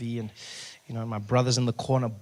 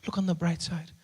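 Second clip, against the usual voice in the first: soft imitated mother; gentle, hushed consolation; reassuring mother; 3 semitones higher, 7 dB quieter than usual. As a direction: soothe a crying child quietly. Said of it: "And she says".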